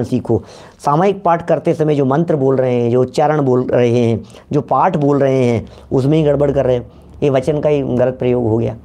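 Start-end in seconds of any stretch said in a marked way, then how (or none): none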